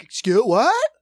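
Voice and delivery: very high, loud mock confusion; playing dumb. Rope-a-doping the scammer, high and loud mock bafflement. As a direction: squeal the question like you're clueless. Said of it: "I was like".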